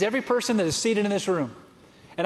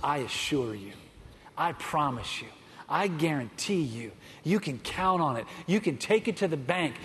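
low, soft, reassuring promise; Jesus quietly promising the dying criminal, low and soft; tender assurance; 6 semitones lower, 6 dB quieter than usual. Retold or imitated. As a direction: speak it low and gently, like a vow. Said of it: "here's what Jesus said".

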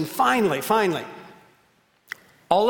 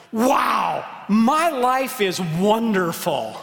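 put-on higher, slow-stretched childlike wonder; yelling with delight; 4 semitones higher, 3 dB louder, much slower than usual. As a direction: imitate a delighted little girl calling out. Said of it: "and she's yelling".

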